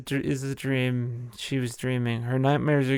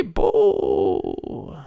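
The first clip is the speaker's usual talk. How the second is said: a much lower, growled-down boo; a low, louder mock boo; 11 semitones lower, 4 dB louder than usual. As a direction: drop the boo deep as a joke. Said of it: "I touch the mic 'cause I'm angry".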